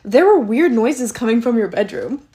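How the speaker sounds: dad's loud complaint, imitated; mock loud accusation. Dad confronts daughter about weird noises, mock loud accusation. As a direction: raise your voice as a bewildered parent.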